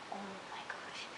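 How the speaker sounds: breathy unvoiced whisper of shock. Vlogger reacting to a friend's tiny dress, whispering. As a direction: whisper it like you can't believe it.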